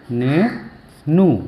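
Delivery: Nenu very slowly, split into ने and नू with a long gap; syllable by syllable. Shown as a demonstration. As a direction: teach Nenu in two drawn-out, separate pieces. वही शब्द तोड़कर सिखाना. (नेनू को तोड़कर, बहुत धीरे बोलें)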